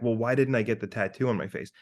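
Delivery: usual speaking voice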